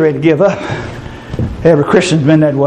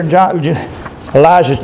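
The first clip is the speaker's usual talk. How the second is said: ordinary delivery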